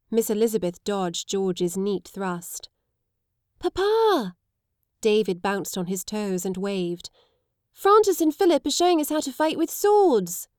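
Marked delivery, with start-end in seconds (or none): 3.6-4.4 put-on a boy calls Papa much higher; excited little boy shouting for his father; high child's call. 7.7-10.5 put-on high, louder eager child; a little boy bursting to tell his father; excited boy.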